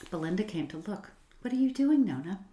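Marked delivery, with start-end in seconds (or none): 1.4-2.4 light high call to her sister; bright curious call; child calling out. A narrator's reading.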